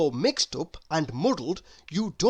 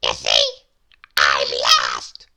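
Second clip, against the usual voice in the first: very low, loud little man voice; Mister Small asking; 6 dB louder than usual. Acted. Lost Mister Small asks for help, very low voice. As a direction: ask politely in a deep voice. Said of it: "said Mister Small".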